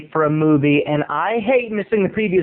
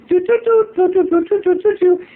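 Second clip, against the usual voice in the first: imitated phone ring; 12 semitones higher, 3 dB louder than usual.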